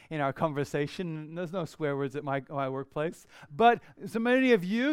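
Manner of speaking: ordinary talk, nothing marked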